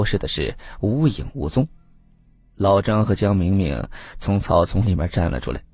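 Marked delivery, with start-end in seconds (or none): none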